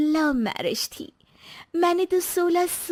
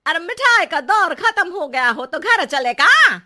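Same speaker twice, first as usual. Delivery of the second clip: louder, sharp call to the husband; annoyed wife; 2 semitones higher, 8 dB louder than usual. Acted. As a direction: cut in and call him home. Wife interrupts husband's visit. (पति को घर बुलाती पत्नी, ऊँची झुँझलाई आवाज़ में)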